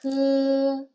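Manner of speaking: H held as a level syllable; initial sound H. Shown as a demonstration. Teacher isolates the initial of 好, sounded out. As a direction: sound out H alone, long and steady. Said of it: "initial for 好".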